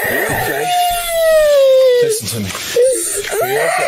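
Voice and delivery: loud concerned calling; shouting. Real, not acted. Officer shouting at crying child.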